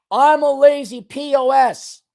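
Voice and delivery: high, slow, forceful declaration; emphatic self-rebuke. Shown as a demonstration. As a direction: say it like you mean it. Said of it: "say it like you mean it".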